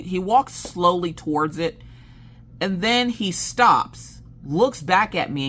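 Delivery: normal talking voice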